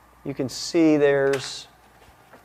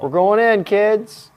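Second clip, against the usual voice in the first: louder, higher, playful; playful announcement; 8 semitones higher, 7 dB louder, slower than usual. Real, not acted. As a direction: announce it like an adventure. Zooming the camera in.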